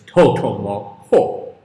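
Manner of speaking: low, loud, slow furious giant growl; angry giant. Acted. Giant scolding Totomo for cheating, low angry roar. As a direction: roar it slowly, all fury. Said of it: "he said, very angry".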